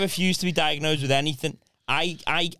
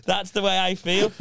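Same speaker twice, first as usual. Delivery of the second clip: ordinary delivery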